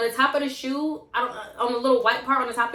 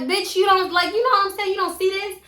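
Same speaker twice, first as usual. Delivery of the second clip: higher and louder retold telling-off; exasperated yelling at her friend over ruined shoes; raised voice; 9 semitones higher, 4 dB louder than usual. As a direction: snap at her, holding up the ruined shoe. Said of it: "I ended up raising my voice a little bit".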